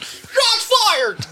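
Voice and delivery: high screamed call; screaming. Retold or imitated. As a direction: scream it like a crowd. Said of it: "That's Mike screaming".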